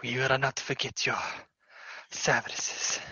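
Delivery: a small creature murmuring its thanks; kobold voice. Acted. Kobold farewell to party.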